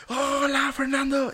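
a high, drawn-out hello; calling out. Retold or imitated. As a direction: call the greeting out brightly from up close. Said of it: "¿Por qué me gritas?".

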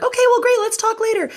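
very high, hammed-up polite sign-off; fake cheerful. Manager escaping the new director's office, falsely cheerful high voice. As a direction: wrap it up in a squeaky, over-bright voice. Acting out escape from awkward chat.

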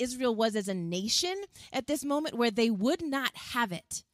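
ordinary talk

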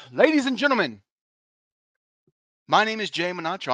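high announcer opening; host intro. Acted. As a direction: open the show like a ring announcer.

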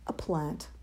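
low, soft, sheepish answer; meek admission. A narrator's reading. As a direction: let it drop meekly. Chick meekly names his choice.

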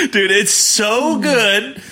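high, slow laughing. Real, not acted. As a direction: talk through laughter. Laughing after the joke.

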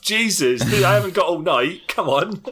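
mock impatience. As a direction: badger him to hurry up, playfully. Impatient Guardian in role play.

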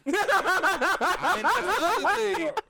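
drawn-out laugh; long laugh. Real, not acted. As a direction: laugh hard at the jab.

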